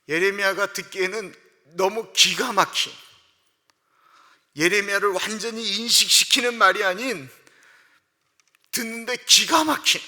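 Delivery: loud, high, fervent sermon climax; preaching at full force. Acted. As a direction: drive the point home to the congregation. 설교 절정에서 힘주어 외침. (높고 크게 힘주어 외치는 설교)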